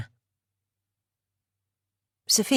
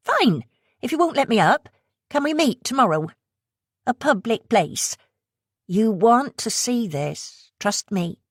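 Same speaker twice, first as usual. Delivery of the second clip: coaxing West Country woman; 7 semitones higher than usual. Acted. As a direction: tempt her in a West Country accent. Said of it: "the accent heavy West Country".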